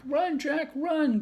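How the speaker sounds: high cry to run. A narrator's reading. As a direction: call out in alarm. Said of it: "cried Annie".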